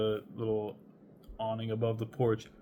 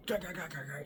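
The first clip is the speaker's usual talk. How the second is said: mock gunfire sounds; 7 semitones higher, 6 dB quieter, faster than usual. Imitating gunfire upstairs.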